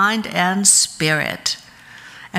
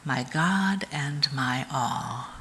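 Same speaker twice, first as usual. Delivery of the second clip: slow mantra repeated much softer; slow, softer repetition; 3 semitones lower, 7 dB quieter, slower than usual. Shown as a demonstration. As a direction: let it sink inward, quieter and slow.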